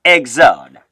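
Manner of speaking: Exode said "eg-ZOD"; low, firm Exode, second syllable falling; French pronunciation. Shown as a demonstration. Teacher shows beginners how French says it, Exode as "eg-ZOD". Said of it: "Let me hear you again".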